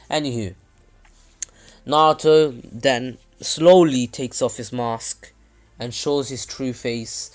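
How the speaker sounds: normal talking voice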